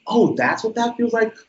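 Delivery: high, surprised "oh" of sudden understanding; a lightly played aha moment; mock realization. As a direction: act out a kid finally getting it.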